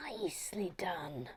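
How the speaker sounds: whispered nicely done; hushed whisper of approval. Real, not acted. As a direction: breathe it out under the breath.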